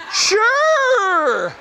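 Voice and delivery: high, stretched-out squeal of assent; mock brave agreement. Asked to ride the dangerous horse, mock brave squeal. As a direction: overdo a yes you dread.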